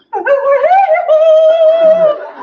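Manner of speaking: very high, loud soaring wail; a huge peak-of-the-mountain cry; mountaintop moan. Acted. Performer demonstrating the mountaintop moan, loud and very high. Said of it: "There's the mountaintop moan".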